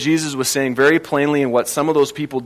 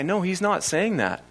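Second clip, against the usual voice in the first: normal talking voice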